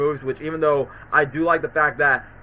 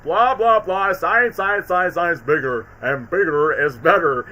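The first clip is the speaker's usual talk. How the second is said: mayor impression; slower than usual. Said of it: "In the words of the mayor".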